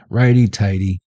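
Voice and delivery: ordinary talk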